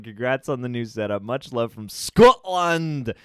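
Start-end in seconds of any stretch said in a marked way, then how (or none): 1.2-3.1 loud, drawn-out attempted Scottish; Scottish accent. Host reading a fan message from Scotland, Scottish accent. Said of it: "chance to do a good accent".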